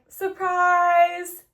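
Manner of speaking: loud, high, drawn-out mock announcement; hammed-up ta-da delivery; gleeful reveal. Character springs his betrayal.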